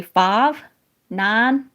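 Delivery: husband's Southern vowels; Southern twang, one word at a time; Southern accent. Retold or imitated. Wife showing how her Tennessee husband talks, Southern accent. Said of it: "He'd say".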